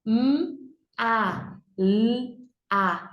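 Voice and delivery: M, A, L, A as isolated sounds "mmm, a, lll, a"; letter sounds. Shown as a demonstration. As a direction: hum M and L long, pause between sounds. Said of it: "Vamos fazer o sonzinho das letras". (sonzinho de cada letra: "mmm, a, lll, a")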